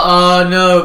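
hammed-up alarm at bad news; mock panic. Imitating friend's panicked cancelling.

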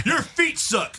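very high mock jab; childish taunt. Friend joining the mock insult match, childish taunt. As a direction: toss in a silly high-pitched insult.

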